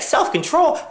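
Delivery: high incredulous squeak on self-control; mocking squeal. Marie scoffing at his self-control lecture, high mocking squeak. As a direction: squeak the word back at him in disbelief.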